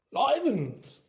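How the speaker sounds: hearty theatrical surprise; mock astonishment. A narrator's reading. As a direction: cry out in fake shock. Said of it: "gave a huge fake jump of astonishment".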